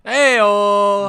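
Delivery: loud, high mock-Italian holler. Joking about looking Italian, cartoon Italian shout. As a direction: belt out a cartoon Italian greeting.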